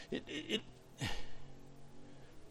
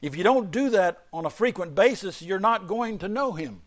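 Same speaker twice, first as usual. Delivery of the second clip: rising, louder preacher's build; preaching; 8 semitones higher, 5 dB louder than usual. Acted. Preacher building to the congregation, raised and louder. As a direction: drive the point home to the congregation.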